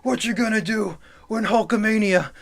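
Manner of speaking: timid Hulk Hogan whisper yell. Retold or imitated. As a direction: hold the shout back to a strained hush. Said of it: "a whisper yell".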